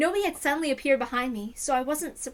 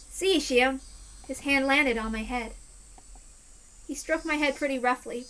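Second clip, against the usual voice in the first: ordinary talk, nothing marked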